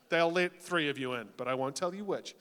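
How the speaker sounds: usual speaking voice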